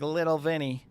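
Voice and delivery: mother's New England way of saying the nickname; mom's New England accent. Retold or imitated. Weather forecaster echoing his mother's nickname for him, New England accent. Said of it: "That's the way she talked".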